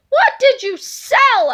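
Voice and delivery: Mister Krupp's voice. Acted. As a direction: grill the kids, slowly. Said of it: "asked Mister Krupp".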